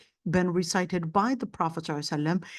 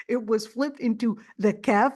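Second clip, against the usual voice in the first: normal talking voice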